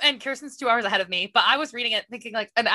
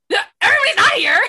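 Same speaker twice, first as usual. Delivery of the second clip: high, loud retort; retold outburst of distress; upset protest; 8 semitones higher, 5 dB louder than usual. Retells her upset reaction.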